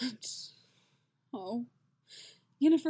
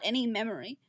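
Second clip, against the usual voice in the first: usual speaking voice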